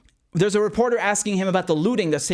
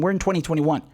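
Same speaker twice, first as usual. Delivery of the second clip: normal talking voice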